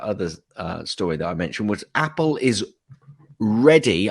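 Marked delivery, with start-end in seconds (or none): 3.4-4.1 READY set apart and hit loud; "ready" said loud with a falling tune; air-quoted READY. Real, not acted. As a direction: punch the word "ready" as if in quotation marks. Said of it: "I'm doing air quotes here".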